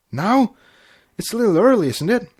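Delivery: high, panicked questions; panicky. A narrator's reading. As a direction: blurt it out in alarm. Flash panicking, high pitch.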